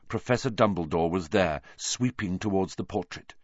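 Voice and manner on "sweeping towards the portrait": plain reading voice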